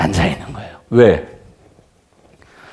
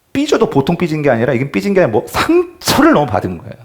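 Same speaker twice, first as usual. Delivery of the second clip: ordinary talk, nothing marked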